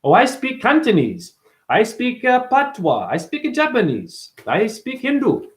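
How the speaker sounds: high, mocking show-off voice; mock boastful claims. Mocking people who brag about languages, high and snooty. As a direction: parrot proud people. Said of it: "No, the fuck you're not".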